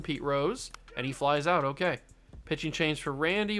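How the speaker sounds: normal talking voice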